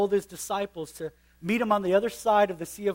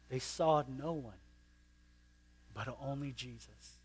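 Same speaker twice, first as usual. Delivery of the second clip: hushed; 5 semitones lower, 10 dB quieter than usual. Shown as a demonstration. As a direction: let it sink in softly.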